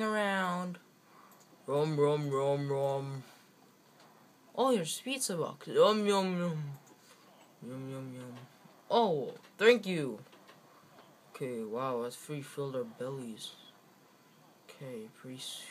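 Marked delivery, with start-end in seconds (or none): none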